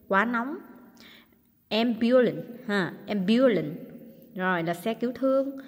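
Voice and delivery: ordinary talk, nothing marked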